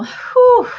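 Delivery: high, loud breathy whew of excitement; excited whew. Real, not acted. Fangirling channeler letting off excitement, high whew. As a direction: blow out the thrill in one high whew.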